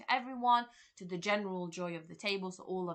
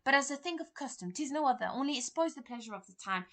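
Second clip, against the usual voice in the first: mild panicky; 6 semitones higher than usual. Acted. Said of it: "Lady Macbeth is sort of panicking".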